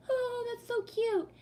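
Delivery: very high, drawn-out cooing falsetto; imitating someone melting over cuteness; mock gushing. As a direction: squeal it slowly like a smitten admirer. Mimics boss gushing over her sneeze.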